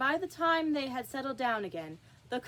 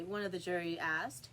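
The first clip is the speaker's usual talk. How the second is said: plain reading voice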